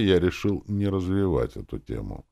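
ordinary delivery, nothing marked

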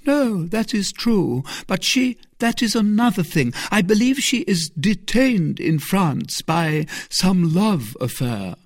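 a hint of a musing nun; the abbess. A narrator's reading. Abbess answers.

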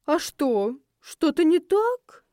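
high, slow, innocent questioning; cheeky mock-innocent lilt; feigned innocence. A narrator's reading. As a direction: play innocent, all wide-eyed surprise. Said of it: "невинно хлопнул глазами этот усопший нахал".

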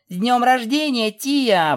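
high, loud birthday cheer; exclaiming. A narrator's reading. Filly presents a birthday gift to princess, exclaiming. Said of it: "воскликнула Пегаска".

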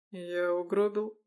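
cold, slow question; icy tone. Acted. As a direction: ask it coldly, without warmth. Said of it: "ледяным тоном".

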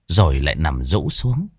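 usual speaking voice

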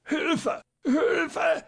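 very high, strangled plea; squeezed falsetto cry of a choking man; choking groan. A narrator's reading. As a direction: groan for help as you suffocate. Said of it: "ächzte der Doktor".

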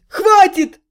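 loud yell to stop. A narrator's reading. Trohim yelling at the howling girl, loud. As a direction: shout her down. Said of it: "заорал Трохим".

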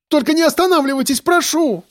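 very high, rushed begging; panicked pleading. A narrator's reading. Woman begging him to keep driving, panicked plea. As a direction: beg fast, in a frightened high voice. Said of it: "закричала Олеся".

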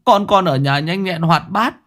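high, urgent begging of a grieving father; desperate pleading. Acted. Grieving father clings to the magistrate's leg, begging in a high voice. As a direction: plead with the magistrate for another examination.

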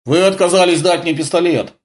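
high-pitched peevish complaint; grumbling. A narrator's reading. Blonde reproaches the major over a pistol, grumbling. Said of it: "проворчала блондинка".

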